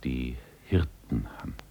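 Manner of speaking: soft whispered narration; tender whisper. A narrator's reading. Narrator whispering a tender touch to the man. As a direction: breathe the words gently. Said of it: "narrator whispers".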